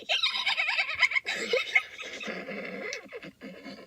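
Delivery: shrill helpless squeal of laughter; high squealing laugh. Real, not acted. Reader cracking up at the cackle line, squealing laugh. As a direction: break into a wild high-pitched laugh. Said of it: "didn't know that was a noise I could produce".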